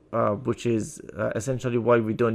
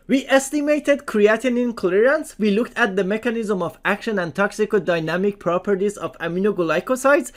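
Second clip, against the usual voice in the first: higher-pitched, emphatic presentation voice; scripted lecture read; 7 semitones higher than usual. Acted. Lecturer introducing next learning objective, scripted high-pitched read. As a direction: announce each point in a raised, projected lecture voice.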